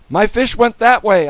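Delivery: put-on high, loud boy's cry; urgent. A narrator's reading. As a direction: point the officer there urgently. Boy directs policeman urgently.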